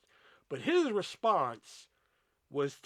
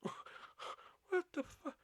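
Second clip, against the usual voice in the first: stunned whisper; 14 semitones higher, 10 dB quieter, much slower than usual. Retold or imitated. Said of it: "And I'm like".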